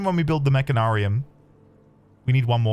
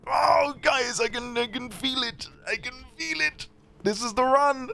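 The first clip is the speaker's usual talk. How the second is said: high, strained excited squeal; giddy excitement; 11 semitones higher, 3 dB quieter than usual. Real, not acted. Senses a winning run.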